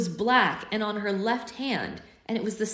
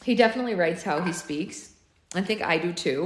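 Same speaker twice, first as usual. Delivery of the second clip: plain reading voice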